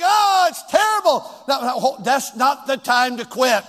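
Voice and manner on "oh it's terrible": shouted high mock wail; hammed-up shouted lament; mock despair. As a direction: cry out like someone who thinks all is lost. Preacher mocks despairing people.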